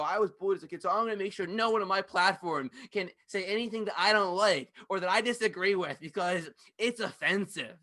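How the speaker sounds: loud, high mock of a self-righteous complainer; mocking whiny complaint. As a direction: sneer at them as you imitate. Mocking people who were bullied.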